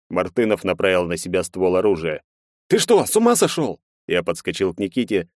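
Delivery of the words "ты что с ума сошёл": alarmed shout. A narrator's reading. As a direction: yell in alarm. Narrator alarmed at gun.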